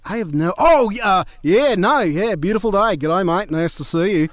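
high, hammed-up Australian accent. Fake Australian student to classmates, in a hammed-up Australian accent. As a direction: play a fake Aussie flustered on campus. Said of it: "I pretended to be Australian".

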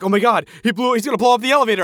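high, loud panicked gasp of alarm; mock freaking out. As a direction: blurt it like you're sure he'll blow it up. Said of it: "I was, like, freaking out".

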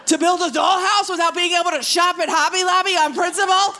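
high, loud comic outrage; exasperated comic rant. Acted. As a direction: rant at a high pitch, worked up.

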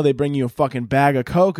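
ordinary talk

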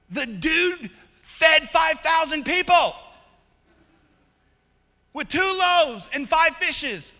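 loud, high, driving sermon voice; shouting climactic sermon; fiery preaching. Acted. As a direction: preach it hard to the whole room. Preaching at full force.

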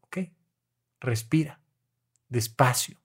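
calm them gently after the crying; quiet reassuring voice, slowed right down; soothing therapist. Retold or imitated. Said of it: "ya ahí les digo".

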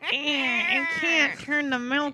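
put-on high, slow, whiny old woman; feeble old lady voice. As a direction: whimper it like a helpless granny. Mocking old weak voice.

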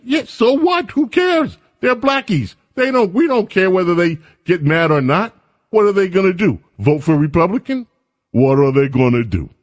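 high, mocking dismissive voice; scoffing adviser. Adviser mocking the governor's worry, high and scoffing. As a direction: scoff like a callous insider.

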